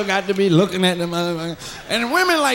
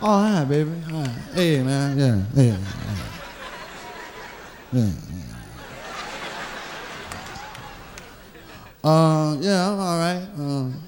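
lower, halting forced nonchalance; awkward fake cool; 5 semitones lower, 6 dB quieter than usual. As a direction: act casual and stumble through it.

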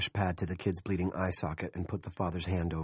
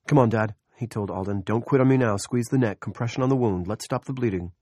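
urgent coaxing; 3 semitones higher, 6 dB louder than usual. A narrator's reading. Medic urging father.

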